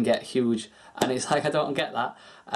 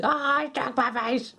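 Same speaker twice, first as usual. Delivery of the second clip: much higher, slow, dreamy put-on cat voice; voicing the cat; 11 semitones higher, slower than usual. Cat asks to be stroked, in a high slow purring voice. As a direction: give the cat a lazy, blissed-out voice. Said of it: "She's like".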